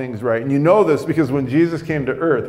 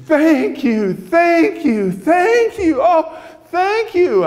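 high, loud overjoyed screams of thanks; woman hugging a stranger, screaming thanks for new tires; joyful screaming; 5 dB louder, much slower than usual. Retold or imitated. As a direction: shriek your thanks with the pitch way up. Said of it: "she's just screaming".

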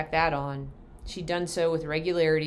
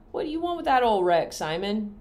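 put-on higher, fussing old aunt asking; a prying country aunt, pitched up; Aunt Mabel's voice; 6 semitones higher, 6 dB louder than usual. Aunt at the supper table questioning Simon, high and prying.

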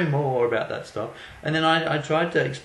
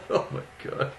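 normal talking voice

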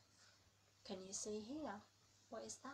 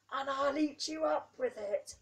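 loud, higher, growly lion threat; hungry lion; 5 semitones higher, 16 dB louder than usual. Acted. Lion threatening the child, loud mock roar. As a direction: roar it like you'll gobble them up.

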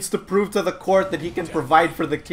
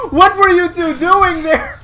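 high, loud accusation; outraged raised voice; indignant scolding; 10 semitones higher, 7 dB louder than usual. Real, not acted. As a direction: tell off the idle teammates loudly. Scolds teammates who did nothing.

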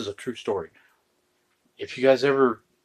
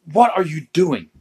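louder, higher, indignant question; mock-outraged wife, loud and raised; exasperated demand; 4 semitones higher, 7 dB louder than usual. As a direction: demand an answer, punching the words. Wife's exasperated second question.

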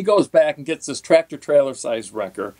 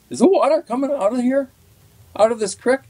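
higher-pitched puzzled question; imitated camera guy; 11 semitones higher than usual. Cameraman asks angler about the creek water, imitated high-pitched questioning.

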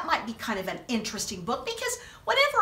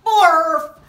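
loud nonsense word; 10 dB louder than usual. Acted. Storyteller forced to read nonsense, loud blurt. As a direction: blurt out Blarf much louder.

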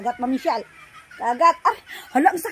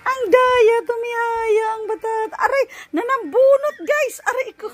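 high, loud yelping complaint; pained playful protest; 7 semitones higher, 6 dB louder, slower than usual. Real, not acted. Baby is pulling hair, high loud protest. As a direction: cry ouch high and loud to the viewers.

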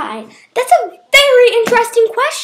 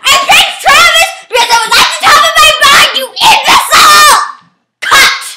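fast, loud, higher rant; mock furious shout; 7 semitones higher, 5 dB louder than usual. Overlord host yelling at the cameraman, furious. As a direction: tear into him, then bark cut.

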